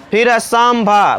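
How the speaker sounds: ordinary delivery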